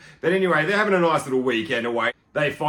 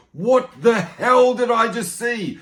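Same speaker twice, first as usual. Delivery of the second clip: slow, louder, higher stunned disbelief; a mock-aghast, drawn-out, raised question; shocked disbelief; 6 semitones higher, 4 dB louder, slower than usual. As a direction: slowly spell out your horror. Reacting to horrifying scene.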